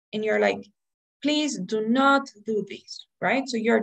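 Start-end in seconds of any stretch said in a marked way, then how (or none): 1.2-3.0 slow, deliberate sentence with emphasis on "not"; "not" stressed in a slowed-down plea. Shown as a demonstration. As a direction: lean hard on the word "not". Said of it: "you tend to stress the negative".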